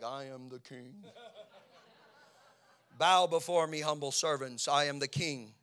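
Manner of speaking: proud, booming play-king voice; boastful kid king. Retold or imitated. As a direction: swagger like a teen crowned king. Teen David plays king with staff.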